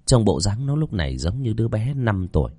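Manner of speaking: ordinary delivery, nothing marked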